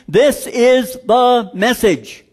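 "This is the message" said slowly, each word weighted; slow down and weigh every word; slow preacher emphasis; slow emphatic. Shown as a demonstration. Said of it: "the middle of everything".

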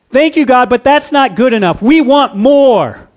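mock whiny complaint. Preacher mimicking ungrateful Israelites, mock whiny. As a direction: whine like a spoiled child never satisfied.

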